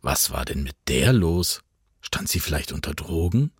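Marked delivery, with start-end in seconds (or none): none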